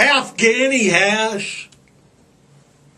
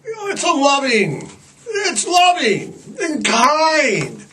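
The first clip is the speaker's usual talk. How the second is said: hammed-up pious sweetness; 5 semitones higher than usual. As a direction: lay it on sweetly like a smug believer. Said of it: "they're like".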